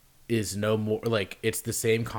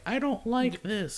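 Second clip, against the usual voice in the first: high mock whine; 13 semitones higher, slower than usual. Voicing Meghan.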